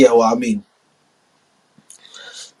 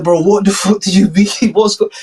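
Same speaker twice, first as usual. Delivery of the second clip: mock Trump; 8 semitones higher, 3 dB louder than usual. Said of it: "It's like".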